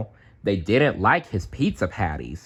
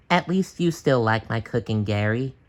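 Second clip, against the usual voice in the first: sad SpongeBob. A narrator's reading. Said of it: "he sighed".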